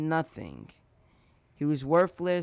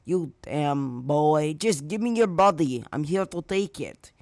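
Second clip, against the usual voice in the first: sludge villain voice; 5 dB louder than usual. Acted. Sludge villain to Izuku after being burst apart, insistent villain voice. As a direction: press the victim like a frustrated monster. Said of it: "The sludge villain starts to speak again".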